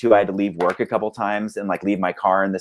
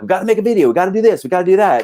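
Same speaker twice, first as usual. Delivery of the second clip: higher, louder, pushing hard; rally the band like a nagging leader; pushy bandmate; 9 semitones higher, 4 dB louder, much faster than usual. Retold or imitated. Pushing the band to make a video, eager and insistent. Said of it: "I'm like".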